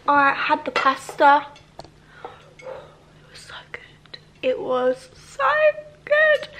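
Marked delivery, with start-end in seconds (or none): 2.6-4.2 breathy awed whisper. Real, not acted. Telling viewers in a whisper how good the pasta was. Dramatic whisper after tasting. 4.4-6.5 drawn out, then a squealed high, loud good; excited squeal. Real, not acted. Gushing to viewers, squealing with delight about the pasta.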